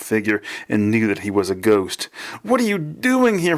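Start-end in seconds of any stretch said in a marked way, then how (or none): 2.4-3.6 high, shocked outcry; shouted. A narrator's reading. Son to his father's ghost, high shocked shout. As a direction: exclaim it high in disbelief. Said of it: "he repeated".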